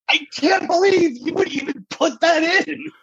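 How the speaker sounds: high, drawn-out scandalized cry; appalled disbelief. Retold or imitated. Contestant scolding judges over the question, appalled disbelief. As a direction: squeal it slowly in hammed-up outrage.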